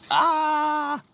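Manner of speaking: high loud yelp after a dare; short scream. Acted. Dared by viewers, he lets out a loud yelp. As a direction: let out a sudden shriek.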